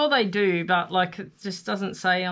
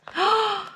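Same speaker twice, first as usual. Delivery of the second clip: delighted gasp; 12 semitones higher than usual. Real, not acted. Gasp at pretty paper.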